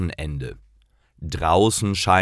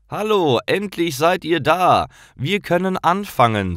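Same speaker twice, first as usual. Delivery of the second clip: put-on higher, cheerful young greeting; cheerful boy Jonas; 10 semitones higher than usual. Jonas greets Paul and Karl in the park, higher cheerful boy. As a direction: greet your late friends brightly.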